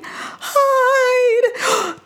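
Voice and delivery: high panicked cry; frightened cry. Acted. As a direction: urge him to hide, alarmed. Brisbane panics at goblins.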